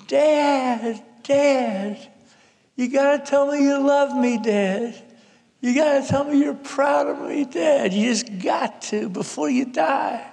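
high, pleading yell at Dad; high-pitched acted scream, begging; retold screaming. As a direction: imitate a frantic daughter demanding love from her father. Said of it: "I hear Carol screaming at my father, screaming".

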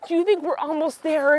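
ordinary delivery

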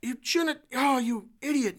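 high, scornful scolding; scolding grandmother. Retold or imitated. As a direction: berate the little girl like a stern grandmother. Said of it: "she called her an idiot".

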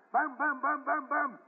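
high punchy bang-bang noises; imitated gunshots. Grandpa mimicking revolver fire for the boy, high and punchy. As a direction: fire off each shot with the mouth.

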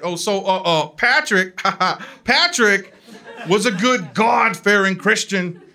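hesitate, then proclaim it to save face; imitating a flustered pastor; pastor trying to recover. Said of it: "thought he could save it".

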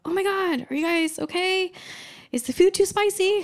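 high-pitched mock concern; imitated alarmed sister. Older sister returns to crying family.

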